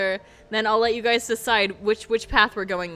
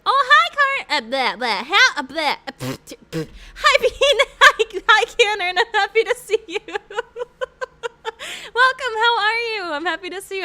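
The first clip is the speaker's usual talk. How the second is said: excited; 10 semitones higher, 8 dB louder than usual. Real, not acted. Greeting chatters excitedly.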